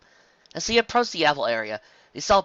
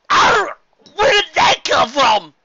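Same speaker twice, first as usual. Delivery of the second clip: startled yell; 12 dB louder, much slower than usual. Acted. Applejack nearly crashing into oven.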